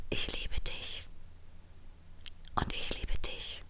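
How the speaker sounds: very soft, voiceless whisper. A narrator's reading. Line and Trini whisper love under the blankets, whispered. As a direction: whisper it tenderly, barely audible. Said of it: "Sie flüsterten sich gegenseitig noch einmal zu".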